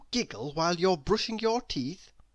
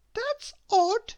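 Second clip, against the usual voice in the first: very high, small puzzled voice; a little girl wondering aloud; 5 dB louder than usual. Acted. Miss Giggles voiced thinking.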